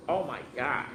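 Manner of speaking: ordinary delivery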